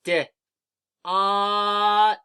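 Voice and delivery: D then ot, each sound held long and loud; D, then ot, sounded out slowly and loudly; sounding out words. Shown as a demonstration. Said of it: "Let's say the sounds again".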